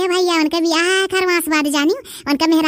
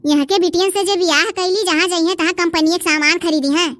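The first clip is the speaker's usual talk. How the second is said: normal talking voice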